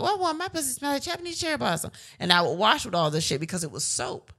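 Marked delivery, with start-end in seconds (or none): 0.0-1.9 high, quick mock-delighted voice; naively pleased younger self. Quoting her naive younger self.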